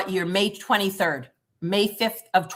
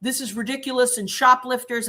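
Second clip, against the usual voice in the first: higher, faster whiny complaining; mocking an officer; 4 semitones higher, faster than usual. Imitating an officer complaining about shoplifters, mock whiny. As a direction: mimic a griping cop, rattling on. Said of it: "So he was like".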